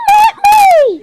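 very high squeak; squeaky toy voice. Pretend. Kid voicing toys, squeak.

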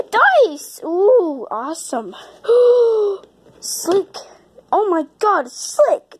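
ordinary talk

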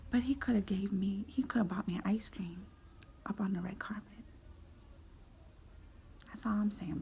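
soft, unvoiced mock sulk; whispered grumble. Real, not acted. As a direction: mutter a petty wish under your breath.

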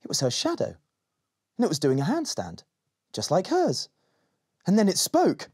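usual speaking voice

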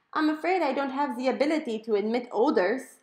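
high, loud, hammed-up German accent; an overeager German butler voice; German computer squeal. A narrator's reading. Home computer answering Mom, squealing in a German accent. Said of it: "high-pitched German accent".

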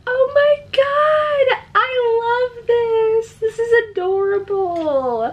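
high, drawn-out squeal of delight; overjoyed squeal. Real, not acted. Expectant mother adoring a baby robe, overjoyed squeal. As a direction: squeal it slowly, easing down at the end.

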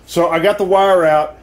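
ordinary talk

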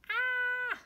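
high, loud mock scream at a toy spider; playful fake spider scream. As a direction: shriek in pretend fright. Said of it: "mock fright at a spider".

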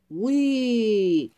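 high, loud whee of delight; happy cheer. A narrator's reading. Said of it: "cheered Peyton happily".

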